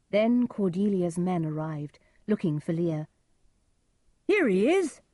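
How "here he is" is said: loud, high call to the others. A narrator's reading. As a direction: call out the discovery. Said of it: "called their leader".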